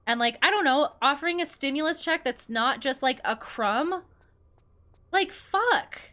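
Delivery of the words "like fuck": high, sharp exasperated swear; real exasperation, pitched high; exasperated curse. Real, not acted. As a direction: vent the frustration. Exasperated outburst.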